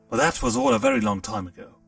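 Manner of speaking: plain reading voice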